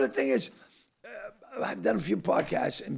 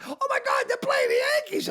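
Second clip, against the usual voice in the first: very high-pitched excited squeal; imitated excited wife; 4 dB louder than usual. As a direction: squeal the news from the kitchen. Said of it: "She goes, in the kitchen".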